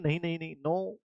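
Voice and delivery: soft squeaky little fish voice pleading no; fish saying no. Pretend. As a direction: play a tiny fish refusing, softly. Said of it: "वो क्या बोलती है?". (मछली की धीमी, नखरीली ना)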